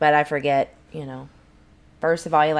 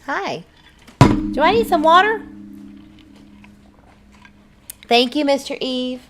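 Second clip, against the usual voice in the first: spoiled sing-song; 9 semitones higher, 5 dB louder than usual. Real, not acted. Playfully asking husband for water.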